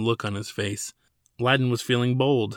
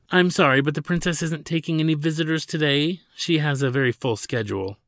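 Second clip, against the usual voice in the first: polite receptionist; 5 semitones higher, 3 dB louder than usual. Acted. Receptionist turns visitor away.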